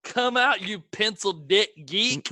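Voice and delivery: mock yell at a hidden peeping tom; yelled movie insult. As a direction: call out the insult, starting high and easing off. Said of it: "And then he yells".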